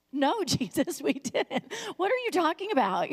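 hammed-up confused disciple; mock bafflement. Voices baffled disciples answering Jesus.

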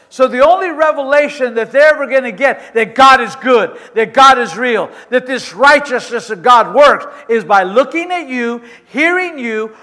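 rising, forceful sermon delivery; preaching. Acted. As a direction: preach it out to the congregation.

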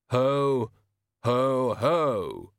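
flat, forced Santa laugh; a wooden ho ho ho; stiff ho ho ho. A narrator's reading. Death tries the laugh.

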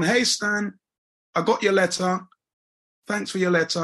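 mother's voice. Retold or imitated. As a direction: voice mum brightly greeting her son. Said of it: "She's gone".

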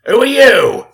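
high, loud Cockney zombie demand; Billy's Cockney voice. Acted. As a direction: bark it out as the risen Billy. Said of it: "That's the same voice".

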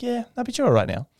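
high, quick, happy imitation; chipper surgeon. Surgeon reassures patient, high quick chipper imitation. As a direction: brush off disaster with cheery speed. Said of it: "she is a very chipper woman, very happy".

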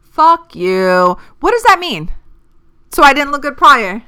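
outraged at a backhanded compliment; indignant. Real, not acted. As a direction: push back, affronted and loud. Offended reaction to compliment.

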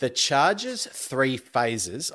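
slow, spaced-out question; deliberately slow. Real, not acted. Host reads Alistair a tricky question so he can compute it, slowly. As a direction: spell it out slowly. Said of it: "I'm gonna say this slowly".

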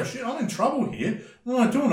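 high-pitched, alarmed self-talk; mock panic. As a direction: act out a sudden wobble of confidence. Fighter acts out panicked self-talk.

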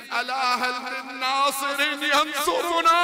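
crying out to heaven. Acted. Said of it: "crying out towards heaven and saying".

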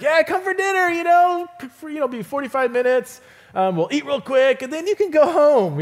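high, bright-pitched mock hostess voice; friend's breezy invitation. Preacher mimicking a friend's quick dinner invite, high mock voice. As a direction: imitate the friend inviting you for a quick meal. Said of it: "you know, it's like".

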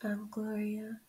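soft whispered name; whispering. Pretend. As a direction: whisper it sadly. Mock sad whispered intro.

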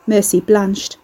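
plain reading voice

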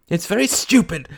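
high, loud, angry cry; angry, high-pitched retort; flash of anger. Acted. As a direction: flare up suddenly. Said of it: "cried Mary with a flash of anger".

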